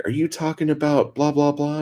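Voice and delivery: mild mock yell from a window. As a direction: imitate a neighbor calling down. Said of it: "somebody fucking yelled out their window".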